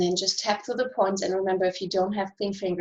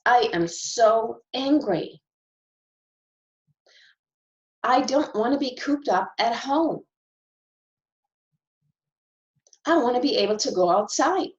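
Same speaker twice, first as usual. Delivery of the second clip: demonstrated frustration; 3 semitones higher than usual. Shown as a demonstration. Tapping coach venting frustration for viewers, raised and fed up. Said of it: "I'm gonna start with some frustration".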